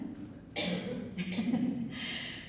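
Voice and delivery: soft, mostly voiceless laugh. Acted. Job candidate pleased with his dismissal strategy, soft laugh.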